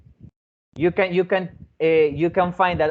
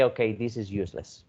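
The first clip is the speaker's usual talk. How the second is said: bored dismissal; 6 semitones lower, 6 dB quieter than usual. Retold or imitated. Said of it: "they're going to say".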